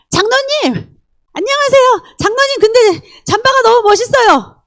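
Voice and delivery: high, chirpy praise; bright eager greeting. Retold or imitated. Believer greets the elder and praises his jacket, high and chirpy. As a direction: gush cheerfully at the elder.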